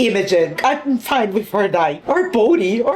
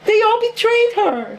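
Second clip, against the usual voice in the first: high, wailing disbelief; upset, high-pitched outcry; distraught protest; 10 semitones higher than usual. Real, not acted. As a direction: wail it on the betrayed heroine's behalf. Upset at characters' betrayal.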